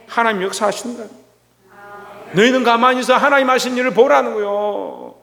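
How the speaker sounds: normal talking voice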